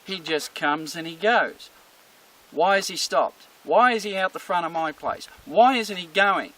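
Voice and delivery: indignant, rising complaint as the puzzled dog; worked-up dog. Acted. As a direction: play a dog getting agitated. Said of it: "starts getting a bit worked up".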